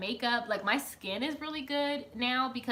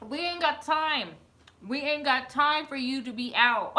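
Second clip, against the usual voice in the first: bossy friend; 5 semitones higher, 4 dB louder than usual. Retold or imitated. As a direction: order Will inside like Maria. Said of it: "Maria said".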